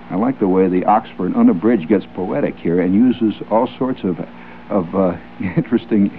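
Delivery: ordinary talk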